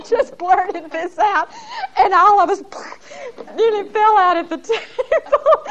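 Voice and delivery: high, laughing through the words; can barely get it out for laughing. Real, not acted. Remembering the dinner table shock, laughing hard.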